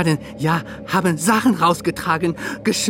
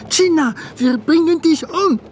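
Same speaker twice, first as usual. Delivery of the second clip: mob shouting; 9 semitones higher, 4 dB louder than usual. Retold or imitated. Mob threat retold.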